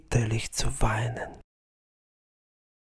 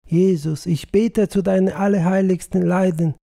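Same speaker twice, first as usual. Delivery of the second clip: loud, higher fervent prayer; praying aloud; 5 semitones higher, 5 dB louder than usual. Acted. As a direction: cry out to the saints for his soul. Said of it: "betete laut".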